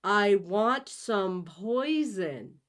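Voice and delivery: slow, loud over-explaining. Acted. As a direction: spell it out as if to a fool. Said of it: "You speak loudly and slowly".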